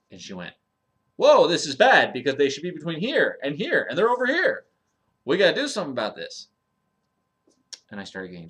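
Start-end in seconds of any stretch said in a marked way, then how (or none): 1.2-6.5 alarmed doctor. Retold or imitated. Doctor alarmed at blood test.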